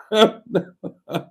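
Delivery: high burst of laughter; real, breaking-up laugh; amused laugh. Real, not acted. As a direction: laugh out loud at your own idea. Amused by own intro plan.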